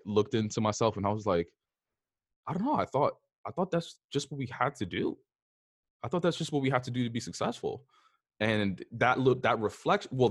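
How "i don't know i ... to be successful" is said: higher, softly uncertain retold reply; mildly lost, justifying yourself in a raised pitch; bewildered. Niece answering her aunt about work, bewildered.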